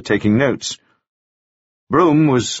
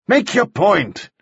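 slow, higher, firm command; impatient order; 11 semitones higher, slower than usual. Acted. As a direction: demand he get on with it. Judge orders Broom.

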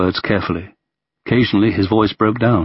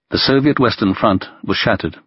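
ordinary delivery

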